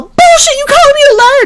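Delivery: very high, loud mock shout of the sergeant; mock angry yell. Sergeant accusing the dorm chief of lying, mock shout. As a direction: fire back the accusation in a hammed-up yell. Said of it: "And she's all".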